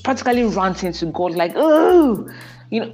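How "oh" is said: a high, loud frustrated "Oh"; exasperated groan. Retold or imitated. Wife ranting to God in the shower, loud frustrated cry. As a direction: let out a fed-up cry to heaven.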